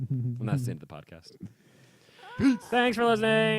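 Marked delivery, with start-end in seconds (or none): 2.7-3.6 loud, high, held-out farewell call; hammed-up sign-off. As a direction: belt out a mock podcast goodbye. Exaggerated podcast sign-off.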